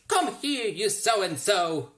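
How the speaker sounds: high, loud, slow yell. A narrator's reading. Boy yelling at the anteater, high, loud and slow. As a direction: bellow it slowly, drawing out so-and-so. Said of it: "boy yelled".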